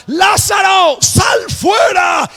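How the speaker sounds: full-force, high, drawn-out shout; commanding shout. Acted. The Lord calls Lázaro out of the tomb, shouting. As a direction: bellow the command, stretching out each word. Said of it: "Yo voy a gritar".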